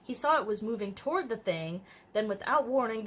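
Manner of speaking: usual speaking voice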